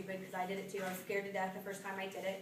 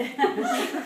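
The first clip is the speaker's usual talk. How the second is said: loud delighted laugh; 10 semitones higher, 14 dB louder than usual. Real, not acted. Laughs while retelling the excitement.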